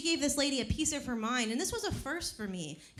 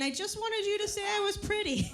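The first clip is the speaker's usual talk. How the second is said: crying; 9 semitones higher than usual. Retold or imitated. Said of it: "ran out of the room crying".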